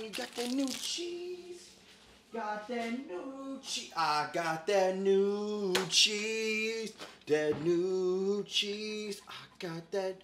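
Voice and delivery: drawn way out, slow and quiet; slow soft drawl. Acted. Host gloats over his new cheese in a slow soft drawl. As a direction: savor each word lazily.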